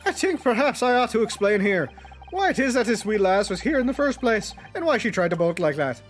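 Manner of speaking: lightly voiced Irish old man; Irish accent. Voices Irish witness in game.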